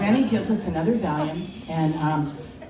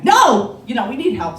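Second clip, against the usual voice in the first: a loud, high demand; a husband yells at hospital staff for help; insistent yell; 7 semitones higher, 11 dB louder than usual. Retold or imitated. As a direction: shout down the queue for help. Steve demands help at the counter.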